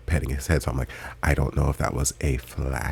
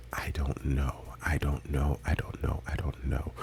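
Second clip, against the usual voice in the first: an unvoiced whisper, trailing off; 9 dB quieter than usual. Real, not acted. Musing on the anime plot, whispering to herself. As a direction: whisper it to yourself, baffled. Trailing off, unsure about theory.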